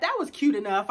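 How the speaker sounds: ordinary talk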